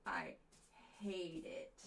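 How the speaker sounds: soft, slow, breathy put-down; cold critic. Retold or imitated. Mean viewer dismissing the droid, cold. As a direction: sneer it low and slow. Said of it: "you'd be like".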